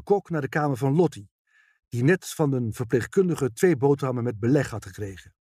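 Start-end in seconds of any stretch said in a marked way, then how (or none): none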